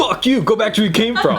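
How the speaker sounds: mock angry high shout, laughing; hammed-up xenophobic American; defensive American. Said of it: "super defensive there".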